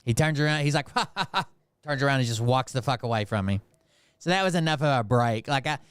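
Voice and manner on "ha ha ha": higher, light mock laugh; imitated laugh. Cop turning around to walk away, mock laughing. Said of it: "He starts laughing".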